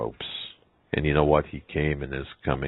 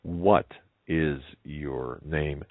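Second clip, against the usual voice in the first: very slow, word by word; deliberate, measured questioning; insistent; much slower than usual. Acted. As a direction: demand it with a pause after the first word. Girl demands the name again.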